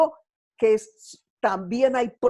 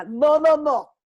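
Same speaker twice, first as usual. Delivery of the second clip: louder, urgent cry of no; 3 semitones higher, 4 dB louder, slower than usual. Retold or imitated. Angel to Hagar, urgent loud no. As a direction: stop her despair with a loud no.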